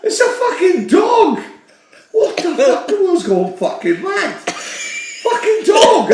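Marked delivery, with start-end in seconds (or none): 0.0-4.3 high laughing outburst; laughing rant. Real, not acted. Mate ranting about vets calling pets, shouting through laughter.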